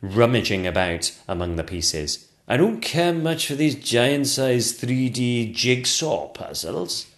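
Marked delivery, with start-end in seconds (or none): 2.5-7.1 grumbling sculptor. Acted.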